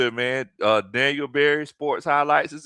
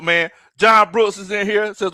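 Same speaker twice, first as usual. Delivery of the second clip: ordinary talk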